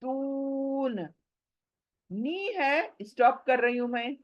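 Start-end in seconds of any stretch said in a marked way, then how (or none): 0.0-1.1 तून with the long u held, softer; stretched long vowel. Shown as a demonstration. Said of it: "वाव मद्दा खींचूंगी".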